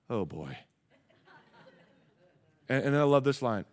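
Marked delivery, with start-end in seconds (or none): 0.1-0.6 low, quiet, reluctant aside; hushed mock dismay, dropped very low; acted pastor's dismay. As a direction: say it under your breath, dreading the request.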